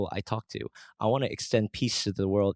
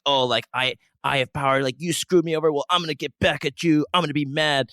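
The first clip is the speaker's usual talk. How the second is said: acted indignation, rising higher and louder; angry and indignant; 5 semitones higher, 5 dB louder than usual. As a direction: build up a mock threat of payback. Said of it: "angry and indignant".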